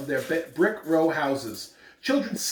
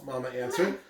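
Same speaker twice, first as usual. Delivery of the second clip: normal talking voice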